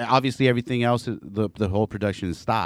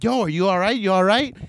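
calling out; 8 semitones higher, 3 dB louder than usual. Retold or imitated. Calling to injured man.

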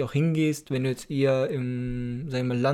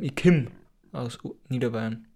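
"komm" as Lower Bavarian "kimm", with an i; 3 semitones lower than usual. Shown as a demonstration. Teacher voicing a Lower Bavarian, dialect "i kimm". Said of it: "der Niederbayer, der sagen".

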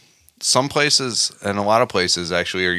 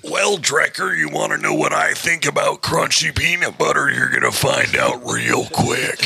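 wrestling promo voice; 8 semitones higher than usual. Acted. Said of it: "descended into wrestling promo Grant".

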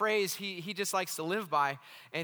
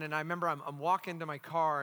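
normal talking voice

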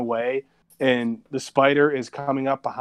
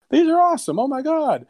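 put-on high-pitched childish excitement, much higher; excited kids; 11 semitones higher, 3 dB louder than usual. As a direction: squeal it like an eight-year-old fan. Kids excited about third movie.

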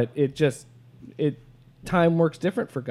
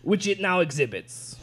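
higher, hammed-up French accent; mock French accent; 5 semitones higher, 3 dB louder than usual. Reading the end of the quote, still in mock French accent. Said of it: "a very offensive French accent".

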